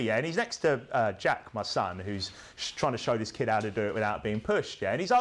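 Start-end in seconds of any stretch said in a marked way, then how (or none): none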